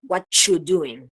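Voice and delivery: slow "What you doing?" with "what you" said as "whatchu"; coalescent assimilation. Shown as a demonstration. Teacher offering another option, "whatchu doing" with a clear ch. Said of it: "Puedo decir".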